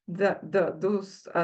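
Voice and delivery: ordinary delivery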